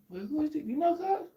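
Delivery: high, soft put-on voice; high-voiced mock questioner; acted street exchange. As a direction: pitch it way up, questioning.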